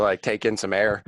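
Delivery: ordinary talk, nothing marked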